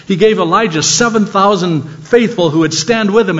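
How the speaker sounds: ordinary talk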